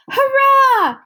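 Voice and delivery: high, loud cheer. Acted. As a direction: cry out in joy. George sees rescue.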